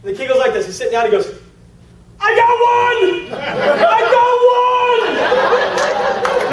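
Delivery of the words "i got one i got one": high, slow, gleeful crowing; gleeful shout. Retold or imitated. The new kid celebrating his prospect, high gleeful crowing. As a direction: crow with childish glee.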